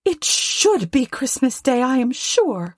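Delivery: high-pitched scolding wife; high, tart indignation; indignant Mrs Cratchit. A narrator's reading. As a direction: rail at the absent boss in a high voice. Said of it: "she said".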